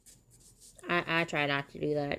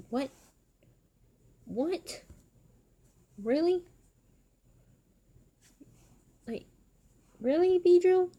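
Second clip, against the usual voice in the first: high-pitched, incredulous questioning; shocked; 12 semitones higher, 5 dB louder than usual. Real, not acted. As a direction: react in shocked disbelief, pitch leaping up. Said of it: "I'm getting shocked right now".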